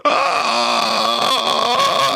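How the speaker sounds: sudden high yelp; startled yelp. Acted. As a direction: yelp in fright. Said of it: "she goes".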